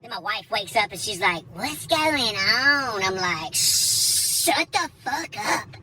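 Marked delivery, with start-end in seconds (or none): 1.5-3.0 very slow, drowsy question; groggy, just woken; a touch of half-asleep mumble; sleepy wife. Retold or imitated. 3.5-5.8 higher, very slow, tense; mock frantic command; paranoid hush. Paranoid hushing wife.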